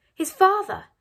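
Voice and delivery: high, loud incredulous echo; alarmed disbelief. A narrator's reading. Mother learning her son went searching, alarmed disbelief. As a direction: repeat it as a shocked question.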